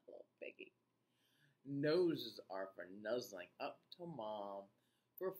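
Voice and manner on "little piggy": soft unvoiced whisper with a laugh. Real, not acted. Reader whispering an aside about a piggy's nose.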